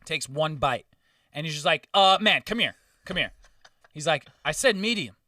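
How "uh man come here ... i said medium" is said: demanding customer. Retold or imitated. Angry customer calls waiter over.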